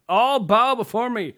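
booming royal command; dark lord. Pretend. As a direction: proclaim like a tyrant king. Playing king with a crown.

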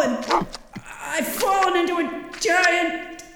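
ordinary talk

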